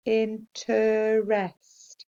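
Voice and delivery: Interest stretched slow as in-ter-est; slow syllable read of Interest; sounded out slowly. Shown as a demonstration. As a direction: sound out Interest in three parts. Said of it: "Use your sounds".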